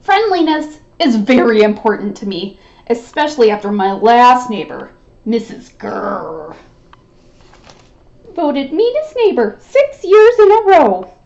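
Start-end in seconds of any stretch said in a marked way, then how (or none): 5.2-6.6 "Grrr" growled low and slow like a snarl; growled name. Acted. Name growled like a mean neighbor.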